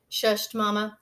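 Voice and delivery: plain reading voice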